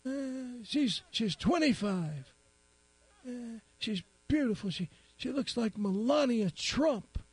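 put-on high, teary old man voice. Crying old man tells the policeman his news, high and weepy. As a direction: play a weepy old timer. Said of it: "He goes".

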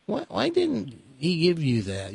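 imitated small grandson. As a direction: gently mimic a disappointed child. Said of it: "in baby talk".

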